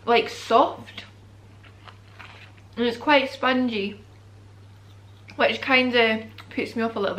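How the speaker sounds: talking with food in mouth; chewing. Real, not acted. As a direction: between bites. Seen 1: Repeatedly bites fries, chews between phrases during labelled words.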